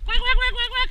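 very high, loud imitated quacking; duck quacks. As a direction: quack like a duck to lure the dog. Duck noise to lure puppy.

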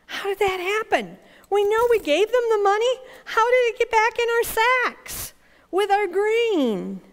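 high-pitched, panicky questioning; acted fright, baffled and urgent; frightened bewilderment. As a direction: ask in alarm, pitch way up. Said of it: "they were frightened because of the money".

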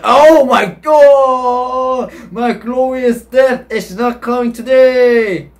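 high, drawn-out cry of despair; melodramatic wail. Pretend. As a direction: wail it out long and high, hammed-up. Mock despair at losing the game.

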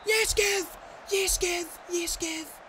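excited cheer. Real, not acted. Cheering the goalkeeper save.

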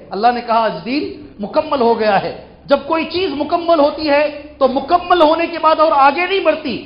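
high, emphatic sermon voice; impassioned preaching. Real, not acted. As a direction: press the argument on the congregation. Preacher explains at raised pitch.

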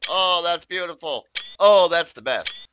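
loud, slow, swooning delight; carried away. Real, not acted. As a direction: gush over the view, stretching it out. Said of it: "I got carried away".

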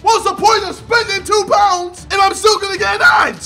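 shouting warnings against the app; real furious shouting. Real, not acted. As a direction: vent full rage at the app. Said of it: "I'm fucking pissed".

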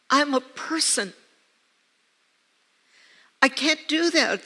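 retelling her anxious plea to God; pleading protest. Retold or imitated. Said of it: "And I said".

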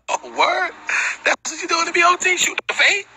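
high-pitched incredulous comeback; retold challenge. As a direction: pitch it way up as a mock-surprised challenge. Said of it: "Cuz, I said".

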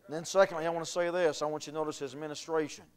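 usual speaking voice

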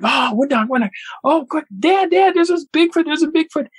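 put-on high-pitched excited little-boy voice; excited kids. Kids telling Dad there's a Bigfoot, excited. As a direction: squeal it like a thrilled kid imitation.

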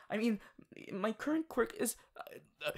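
ordinary talk

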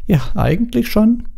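put-on slow, high girlish acceptance; coy yes, drawn out; pitched well up, shy and slow; bashful. A narrator's reading. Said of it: "wurde feuerrot".

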